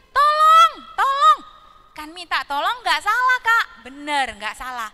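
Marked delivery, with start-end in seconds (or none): 0.1-1.4 high, loud fake cry for help; parrot crying for help. Parrot fakes a call for help to its friends, high loud shout. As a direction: shriek for help like a tricky parrot. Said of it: "Dia teriak-teriak lagi".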